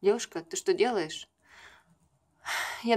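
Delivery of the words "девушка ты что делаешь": ordinary talk, nothing marked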